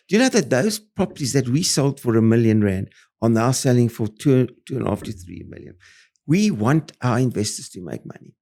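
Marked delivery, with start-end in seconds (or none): none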